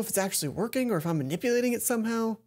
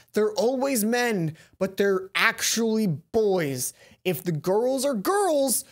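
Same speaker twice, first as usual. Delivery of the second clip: slow, louder, overly grand pronouncement; mock-grand aside; 3 semitones higher, 4 dB louder, much slower than usual. Player teasing the game's wording, slow and grandly mocking. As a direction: declaim it like a big revelation.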